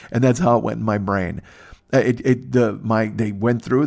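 usual speaking voice

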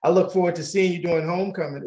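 usual speaking voice

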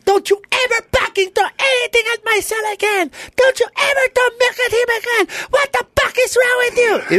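very high furious mom voice; mom yelling. Retold or imitated. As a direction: shriek it like a protective mother. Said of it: "You should have heard my mom".

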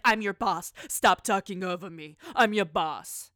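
loud, bossy mock orders; hammed-up boss telling staff off. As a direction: bark it like a pushy boss. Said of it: "she's like".

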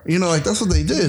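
ordinary talk

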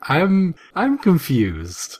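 slow, bewildered little bot; slow confused bug voice. Retold or imitated. Waspinator answers Windblade's questions, slow and bewildered. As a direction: drag it out, baffled.

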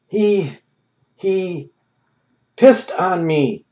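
stammering in disbelief; amazed. A narrator's reading. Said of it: "Ham stated in amazement".

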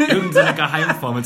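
high, loud burst of laughter into words; laughing. Real, not acted. Joking about the musician's secret formula, laughing loudly.

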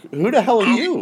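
high-pitched confrontational question, not shouted; reenacted assertive challenge. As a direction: demand it of an intruder, reenacted. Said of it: "I wasn't aggressive".

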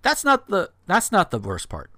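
ordinary talk, nothing marked

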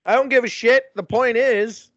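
shouting it out, exasperated; loud outburst. Real, not acted. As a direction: yell it in frustration. Heated rant about the movie.

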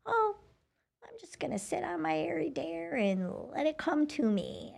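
mocking lazy people. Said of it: "a lot of people out there think".

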